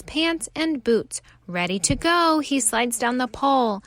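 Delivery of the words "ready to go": plain reading voice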